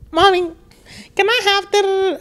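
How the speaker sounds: put-on high sweet child's voice asking mommy; mock little boy. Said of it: "He's like".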